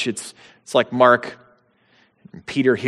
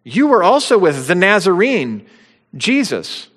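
higher, louder accusing line; accusation; 6 semitones higher, 4 dB louder than usual. Acted. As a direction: point the finger as you say it. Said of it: "felt like an accusation".

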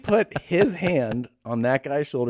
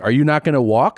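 louder, pushy challenge; retold aggressive question; 3 semitones higher, 6 dB louder than usual. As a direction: imitate an impatient passenger pressing. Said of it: "real aggressive".

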